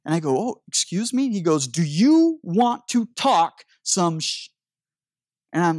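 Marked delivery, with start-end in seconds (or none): 0.4-1.3 high, surprised excuse me; startled. Retold or imitated. Startled by a stranger's challenge, high surprised question. 1.7-4.5 slow, deliberate threat to fight; slow menacing challenge. Retold or imitated. Stranger in grocery line daring him to fight, slow and menacing.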